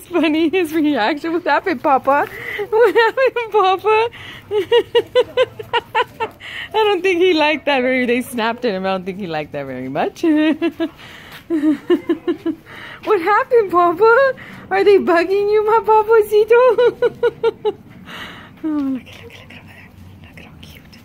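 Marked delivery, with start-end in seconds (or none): 1.3-4.1 loud, high coo. Real, not acted. Cooing to dog. 13.0-17.7 loud, high coo. Real, not acted. Cooing to dog. 19.0-21.0 soft whispered awe; whispering about the cute puppies. Real, not acted.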